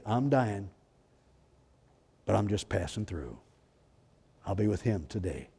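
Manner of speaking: soft, low, calm assurance; peaceful. Retold or imitated. Dying believer speaking calmly of heaven, soft and peaceful. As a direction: say it at peace, gently.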